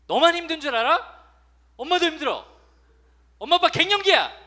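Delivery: 훨씬 높고 크게 소리치는 억울한 엄마 흉내; 나도 힘들다며 언성을 높이는 엄마. Retold or imitated. Parent yelling at teen. (갱년기 엄마가 자식에게 높고 크게 버럭 외치는 소리)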